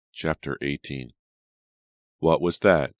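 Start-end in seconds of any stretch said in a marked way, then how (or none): 2.2-3.0 loud, alarmed cry; sudden loud exclamation; cried out. A narrator's reading. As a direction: call it out in alarm, much louder. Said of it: "Jonathan cried".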